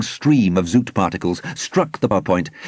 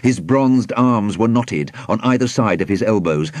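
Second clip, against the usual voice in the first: ordinary delivery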